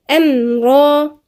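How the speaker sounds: أمرا with a half-held, resonant mim; slow أمرا; tajweed demo; demo of medium mim. Shown as a demonstration. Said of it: "الصوت طالع وسط".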